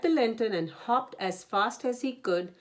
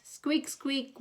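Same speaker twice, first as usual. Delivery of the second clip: high squeaking creak; squeaky lantern; 7 semitones higher than usual. A narrator's reading. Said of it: "went the lanterns".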